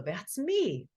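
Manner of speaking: lightly voiced sheep, pleased; proud sheep. Matilda telling the other sheep she is the balloon, proud.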